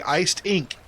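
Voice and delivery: iced ink run together fast until it sounds like I stink; said quickly. Shown as a demonstration. Showing the teammate how iced ink slurs, said quickly. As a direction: blur iced ink into one quick word. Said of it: "you're gonna say it quickly".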